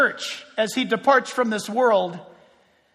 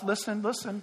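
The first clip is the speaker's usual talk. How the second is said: much softer, drawn-in listen listen; hushed for effect; 5 semitones lower, 12 dB quieter than usual. Shown as a demonstration. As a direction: drop the voice to pull them in before the pause. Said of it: "pause for effect".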